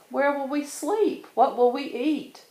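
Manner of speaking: high and fast, anxious little cubs; fretting cubs. A narrator's reading. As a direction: rush the question like worried kids. Cubs fretting about staying away.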